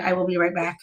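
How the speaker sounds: ordinary talk, nothing marked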